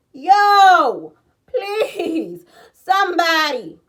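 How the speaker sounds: loud, high, drawn-out plea; calling for help. Retold or imitated. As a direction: cry out to passing cars for rescue. Acts out begging passing drivers.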